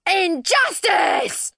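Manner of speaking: high, drawn-out shriek of protest; outraged scream. A narrator's reading. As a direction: scream it out, stretching every syllable. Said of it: "screamed Eugenia".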